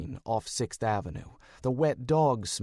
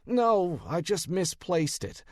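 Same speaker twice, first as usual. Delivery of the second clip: mock offended protest; feigned indignation; 6 semitones higher than usual. A narrator's reading. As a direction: act wounded, not very convincingly. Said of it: "I said with feigned indignation".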